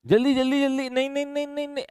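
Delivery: high, hurried teasing urge; urgent teasing. Real, not acted. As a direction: hurry her along playfully. Teacher urging giggling student. (हँसती छात्रा को जल्दी करने को कहते हुए छेड़छाड़)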